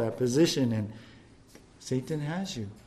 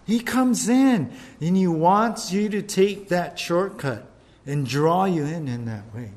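ordinary delivery

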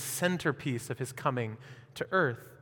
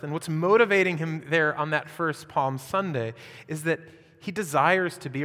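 normal talking voice

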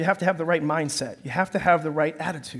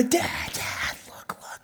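urgent unvoiced whisper; whispered Dad, Dad, look, look; whispering kids; 8 semitones higher, 11 dB quieter, much slower than usual. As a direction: hiss it like kids who spotted something. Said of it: "They go".

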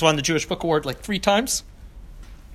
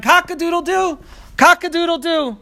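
high crowing cock-a-doodle-doo, much higher; rooster crow; 13 semitones higher than usual. Acted. Prince who thinks he is a rooster, crowing high. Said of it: "strutted about crying".

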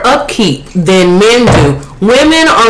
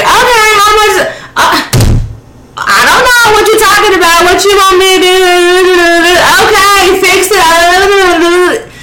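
immature man; 9 semitones higher than usual. Retold or imitated. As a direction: brush her off like a petulant man. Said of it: "an immature one be like".

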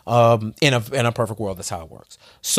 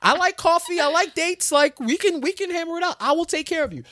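much higher, sweet, pleading would-be lover voice; eager headhunter. Retold or imitated. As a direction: woo him like an ex waiting in the wings. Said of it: "they're like the person that's waiting for you to slip up".

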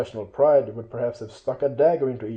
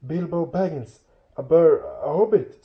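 higher-pitched, nervous little voice; frightened hobbit; 5 semitones higher, 4 dB louder than usual. A narrator's reading. Captured Bilbo naming himself to trolls, frightened and high. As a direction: stammer it timidly, catching yourself. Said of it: "said poor Bilbo, shaking all over".

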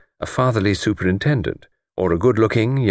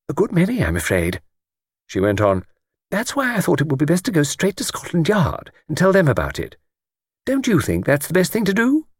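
put-on high, eager elderly lady voice; chatty old lady; 8 semitones higher than usual. Old lady on the train chatting to Luke, high elderly voice. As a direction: play a talkative old lady delighted by a stranger.

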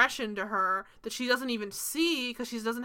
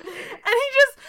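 ordinary talk

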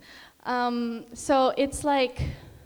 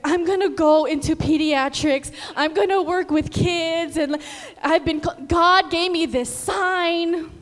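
louder, fired-up declaration; eager resolve; 6 semitones higher, 6 dB louder than usual. Retold or imitated. Student nurse vowing to work with kids, excited and loud. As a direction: announce the plan with excitement. Inspired to choose pediatrics.

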